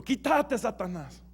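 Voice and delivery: high, sharp cry heard by all; shouted rebuke. Retold or imitated. Jesús rebukes Pedro before the eleven, a high sharp cry. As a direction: call it out sharply so the eleven hear. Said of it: "Jesús le grita a Pedro".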